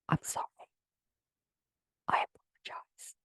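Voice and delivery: soft unvoiced whisper of sorry; whispered apology. Real, not acted. Host whispering a sheepish sorry to listeners. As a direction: breathe the apology, barely there.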